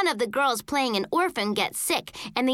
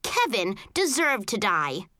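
usual speaking voice

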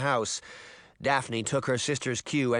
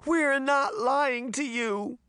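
high, slow wail; tearful, drawn-out high plea; sobbing plea; 13 semitones higher, much slower than usual. A narrator's reading. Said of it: "Daphne sobbed".

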